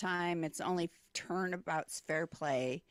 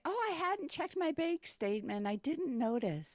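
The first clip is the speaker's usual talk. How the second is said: mock innocence; 10 semitones higher than usual.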